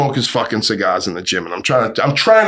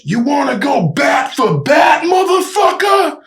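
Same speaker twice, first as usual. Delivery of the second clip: slow, much higher yelled challenge; menacing shout; 13 semitones higher, slower than usual. Retold or imitated. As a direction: roar it like a crazed dad with a bat. Father challenges him with bat.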